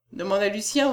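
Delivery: ordinary delivery, nothing marked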